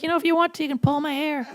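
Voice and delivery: put-on high-pitched little-kid voice; put-on childish squeak; four-year-old girl. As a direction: ask like a small child, voice way up high. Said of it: "She acted like a four-year-old".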